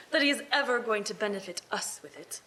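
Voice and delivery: amused laughing aside. Acted. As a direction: chuckle through the words. Laughing at the uncle.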